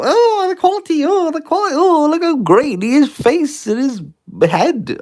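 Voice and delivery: high, breathless mock admirer; mock viewer gushing. Viewers gushing at the streamer, mock high gushing voice. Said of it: "everyone's gonna go".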